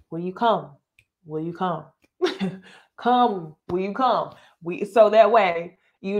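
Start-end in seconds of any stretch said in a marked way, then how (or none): 0.1-4.3 lightly voiced preacher calling folks down; mock pastor inviting the church forward; church altar call. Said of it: "where they say".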